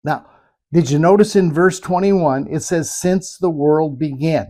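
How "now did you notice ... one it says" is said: normal talking voice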